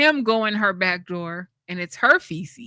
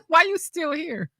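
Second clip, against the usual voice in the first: mocking comeback; 8 semitones higher than usual. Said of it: "I'm like".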